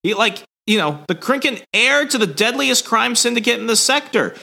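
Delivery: high, frantic report; panicked Tongar. Retold or imitated. Tongar radioing the bad news, high and frantic. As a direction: blurt it out in panic. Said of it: "he's like".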